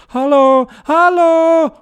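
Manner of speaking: high, loud hello from afar; calling out. Acted. Hikers calling down to the two friends. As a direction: call it up the mountain. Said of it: "die haben gerufen".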